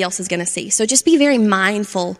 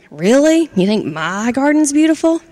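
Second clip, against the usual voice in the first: higher, louder disbelieving question; surprised; 5 semitones higher, 4 dB louder than usual. Acted. Frappy surprised Charlie likes his garden, astonished. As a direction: sound caught off guard.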